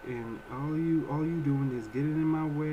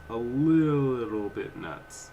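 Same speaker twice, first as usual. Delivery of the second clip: drawn out slowly and a bit louder; slow, weighty repeat to stress the point; slow emphatic; 4 dB louder, much slower than usual. Real, not acted. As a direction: stretch every word out for emphasis.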